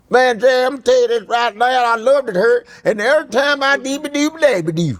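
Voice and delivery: high mock-incoherent rant. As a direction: garble it high like a Jerry Springer guest.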